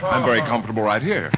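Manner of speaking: usual speaking voice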